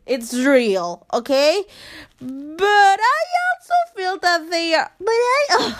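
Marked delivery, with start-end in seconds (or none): 2.2-5.7 voice cracking. Real, not acted. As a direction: voice breaking up on high notes. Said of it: "I hate my voice right now".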